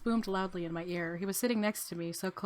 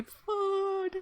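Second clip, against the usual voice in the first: calling out; 12 semitones higher, 3 dB louder than usual. Acted. Calling out for missing friend.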